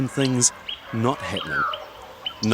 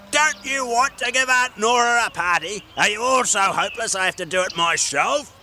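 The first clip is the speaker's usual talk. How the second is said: high, angry gnome scolding; roaring gnome; 10 semitones higher than usual. Acted. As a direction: tell them all off. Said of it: "roared Gordon".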